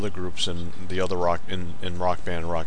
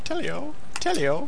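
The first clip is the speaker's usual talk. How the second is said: squeaky mock greeting; 4 dB quieter than usual. Friend mimicking a greeting.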